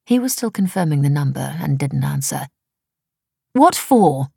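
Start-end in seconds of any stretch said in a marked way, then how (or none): 3.5-4.3 higher, louder puzzled question; baffled demand. A narrator's reading. Wife questioning husband's sudden trip, baffled. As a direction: demand a reason, thrown by it.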